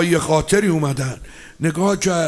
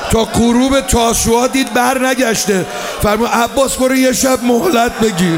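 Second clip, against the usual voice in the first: raised, crying-out mourning sermon; impassioned preaching; 4 semitones higher than usual. Acted. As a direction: build the lament to a cry. Preaching at full force.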